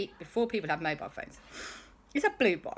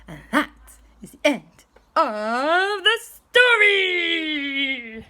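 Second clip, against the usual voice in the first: higher, louder, slow flourish; storybook ending; 8 semitones higher, 8 dB louder, slower than usual. A narrator's reading.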